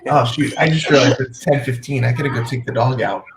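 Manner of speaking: ordinary delivery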